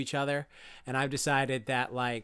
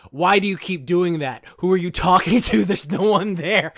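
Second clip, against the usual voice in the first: much louder, exasperated yelling; villain losing it; 5 semitones higher, 11 dB louder than usual. Retold or imitated. Retelling the villain grabbing a turtle, furious yell. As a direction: scream at the turtle in frustration. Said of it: "he's just like".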